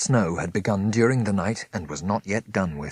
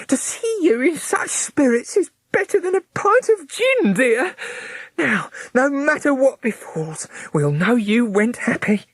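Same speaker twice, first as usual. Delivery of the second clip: much higher, gushing sister; Miss Fanny gushing; 5 dB louder than usual. A narrator's reading. Miss Fanny gushes to Smith, much higher. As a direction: gush with relief.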